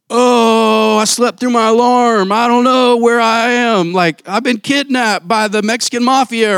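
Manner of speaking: high, loud, hammed-up excuse; flaky friend's excuse. Friend who skipped the move, hammed-up high excuse. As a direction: overplay the friend's wild excuse. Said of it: "they have this crazy story".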